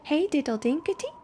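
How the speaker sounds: a hint of mouse voices; lightly squeaky mice. A narrator's reading. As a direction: chirp it like cheeky little mice. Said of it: "answered the little mice".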